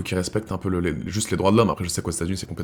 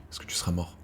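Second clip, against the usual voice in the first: whisper; 4 semitones lower, 16 dB quieter than usual. Real, not acted. Musing to viewers about death, whispered. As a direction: drop to an unvoiced whisper.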